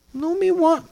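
put-on high, slow whiny little-boy voice; slow, high pouting child; imitating his toddler son. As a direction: play the upset toddler asking for his chair. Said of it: "I won't imitate him".

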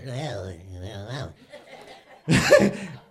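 comic small-dog impression; trembly dog noises hammed up for laughs; imitated little dog noise. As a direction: mimic a nervous little dog. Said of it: "I'm nailing it, aren't I?".

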